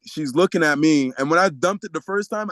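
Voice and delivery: normal talking voice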